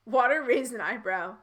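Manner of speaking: ordinary delivery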